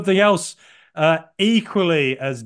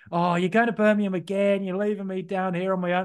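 put-on Australian accent, higher and a bit plaintive; 4 semitones higher than usual. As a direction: mimic his fiancée's mild complaint in Australian. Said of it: "I'm doing an Australian accent".

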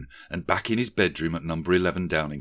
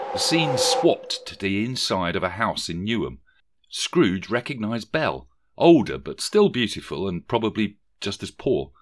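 plain reading voice

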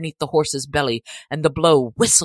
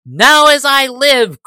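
high, loud, slow outcry; sheriff crying out; 8 semitones higher, 10 dB louder than usual. A narrator's reading. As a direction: burst out in outrage. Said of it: "cried the sheriff".

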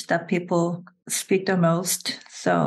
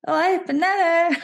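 Seoul-style high rising tune, a touch of mocking; questioning rise; 9 semitones higher, 4 dB louder than usual. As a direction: lift the end up like a question, higher throughout. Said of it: "always sound like questioning".

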